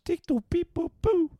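high beeping robot voice; toy robot voice. Pretend. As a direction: chirp it like a little droid. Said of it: "Robot language".

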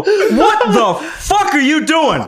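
high, laughing disbelief; shocked retort. Retold or imitated. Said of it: "and I just go".

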